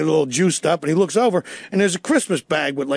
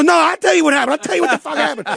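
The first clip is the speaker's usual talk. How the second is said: higher, louder rushed giving-in; eager confession; 9 semitones higher, 3 dB louder, faster than usual. Retold or imitated. Scared suspect caving to the detective, higher and urgent. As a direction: blurt it out to avoid the rabbit. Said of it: "I go".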